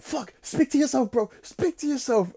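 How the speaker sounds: high, strained voice pleading with himself; inner voice panicking. Retold or imitated. Himself on shrooms begging his inner voice, high panicked plea. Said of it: "And I was just like".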